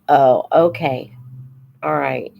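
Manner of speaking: usual speaking voice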